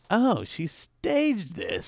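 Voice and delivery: slow dawning realization. Retold or imitated. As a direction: draw it out as it clicks.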